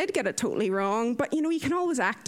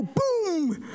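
long, drawn-out boom with falling pitch; slow, higher, showy boom; demonstrated catchphrase boom; 7 semitones higher than usual. Retold or imitated. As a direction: stretch the word out into a big boom. Demonstrates her booming catchphrase.

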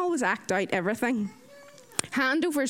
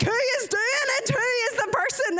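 high-pitched, hammed-up exasperation; exasperated outburst; 12 semitones higher than usual. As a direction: squeal out the question in mock exasperation. Exasperated interjection in retold lesson.

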